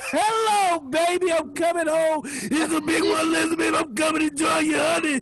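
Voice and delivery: loud high shouting bro; frat bro. Acted. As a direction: yell it out like a wild frat bro. Shouting the sign-off bit.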